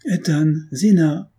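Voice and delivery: high, slow cheerful call from outside; calling cheerfully. A narrator's reading. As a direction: call out brightly, drawn out. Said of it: "appelait gaiement du dehors".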